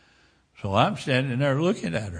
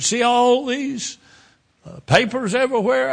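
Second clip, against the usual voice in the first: a shaken woman pointing out her scattered papers; frantic woman; 10 semitones higher, 8 dB louder than usual. Retold or imitated. Woman after the crash points out her papers, high and frantic.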